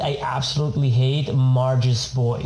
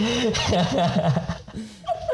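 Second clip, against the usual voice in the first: high laugh; 7 semitones higher, 3 dB quieter than usual. Real, not acted. Laughing at the Spanish line.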